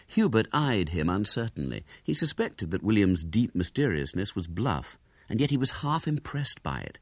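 usual speaking voice

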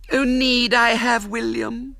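put-on high, slow, whiny little girl; haughty girl begging, high and drawn out; pitiful pleading girl. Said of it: "she pleaded pitifully".

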